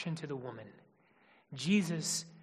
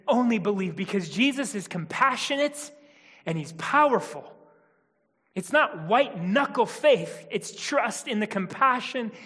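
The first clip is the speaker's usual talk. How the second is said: louder, higher, rising sermon build; preaching at full force; 5 semitones higher, 9 dB louder than usual. Acted. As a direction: preach it home with conviction.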